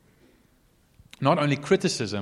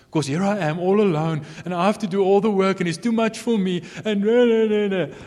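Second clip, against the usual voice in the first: rising, louder whiny complaint; self-pitying whine; 7 semitones higher, 5 dB louder than usual. Acted. Preacher mimics a self-pitying worker, whining. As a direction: whine like someone sorry for herself.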